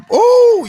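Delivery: sudden high ooh yelp; high hype howl. Real, not acted. Shout out hype yell.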